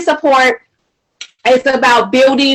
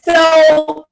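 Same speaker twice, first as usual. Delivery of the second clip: normal talking voice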